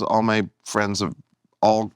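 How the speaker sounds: ordinary talk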